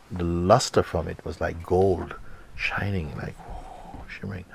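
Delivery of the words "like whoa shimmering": breathless whisper of wonder; awed whisper. A narrator's reading. Describing the glowing nectar in an awed whisper. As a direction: hush it out in amazement.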